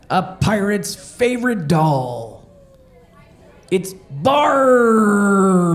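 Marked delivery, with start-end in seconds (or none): 3.7-5.7 loud punchline. Acted. Punchline shouted slowly.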